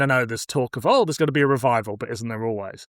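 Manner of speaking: ordinary delivery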